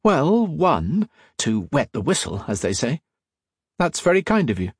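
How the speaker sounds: high, lilting polite acceptance; obliging young gentleman. A narrator's reading. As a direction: give in graciously as the young man. Young agent accepts drink.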